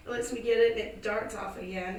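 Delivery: usual speaking voice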